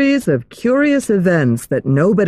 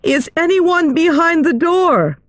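high, loud anxious call; 12 semitones higher than usual. Acted. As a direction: call out loudly through the door. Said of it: "she asked loudly".

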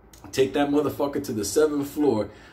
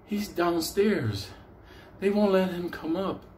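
mock nurse reply; 4 semitones higher, 4 dB quieter than usual. Nurse's answer acted out.

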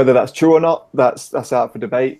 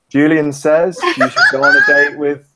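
slow, loud, laughing announcer read; Julian Says announcement; 4 semitones higher, 4 dB louder than usual. Shown as a demonstration. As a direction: announce it slowly through a laugh. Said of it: "It says".

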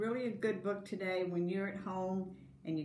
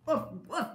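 higher playful woof woof; dog bark; 6 semitones higher, 4 dB louder than usual. Acted. Teacher voicing the dog for kindergarten, playful barking. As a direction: bark it out like a friendly pup. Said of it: "barked the dog".

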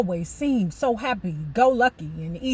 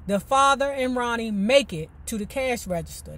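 usual speaking voice

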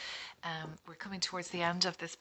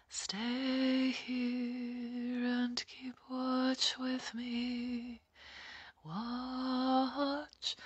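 hoarse, cracking whisper of a voice; slow, breathy, worn-out voice; voice gone; 6 semitones higher, much slower than usual. Real, not acted. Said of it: "Oh, my voice is gone".

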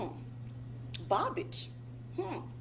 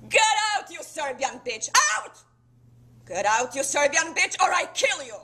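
furious high scream; screaming threat; 4 semitones higher, 12 dB louder than usual. Acted. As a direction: scream them out of the room. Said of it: "she pulls out the gun and screams".

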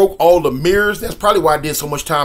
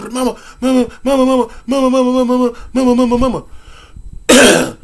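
high, whining repeated calls; imitating a kid bawling for mama; crying for mama; 8 semitones higher, 4 dB louder than usual. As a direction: run to mom, wailing her name. Running to tell mom.

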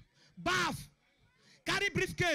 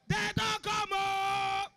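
acted warning shout; 2 semitones higher, much slower than usual. Runner shouts that police are coming, shouted warning. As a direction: call out the warning, holding the last word. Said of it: "I shout".

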